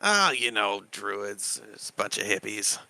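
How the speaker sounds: soft, hesitant Dragonborn with pauses; downplaying Dragonborn. Dragonborn explaining the gnome to a visitor, quiet and hesitant. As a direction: trail off quietly, reluctant to explain.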